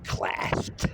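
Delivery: hushed single word; whisper. Pretend. Describing his overhand punch, he whispers the word. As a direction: whisper it for effect.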